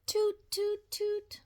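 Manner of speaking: high tooting bear; toy horn toots. Acted. Little bear blowing a horn, high toots. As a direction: toot like a little toy trumpet. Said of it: "goes the little bear".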